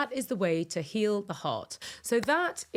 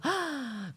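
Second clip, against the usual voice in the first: awed gasp; 5 semitones higher, 4 dB quieter than usual. Acted. Awed build to compassion.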